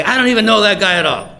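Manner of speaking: fast, loud, high-pitched disowning; vehement denial. Acted. Peter disowns Jesus within his hearing, loud hurried denial.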